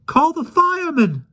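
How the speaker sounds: very high, loud cry; crowd crying out. A narrator's reading. Frightened people call for help, very high cry. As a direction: cry out in panic. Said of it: "they all cried".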